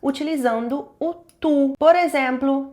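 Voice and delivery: normal talking voice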